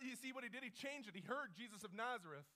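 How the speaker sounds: normal talking voice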